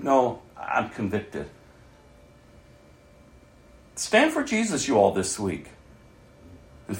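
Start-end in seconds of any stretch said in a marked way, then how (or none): 0.0-1.5 quiet conviction. Retold or imitated. Quiet confession to friends.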